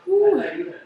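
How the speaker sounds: high exhale after spice; high whew of relief. Real, not acted. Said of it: "my mouth is burning so much".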